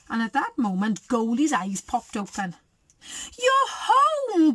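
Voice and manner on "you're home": loud, high-pitched greeting; delighted shout. A narrator's reading. Goldie hugs each returning bear, happy shout. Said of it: "Goldie shouted".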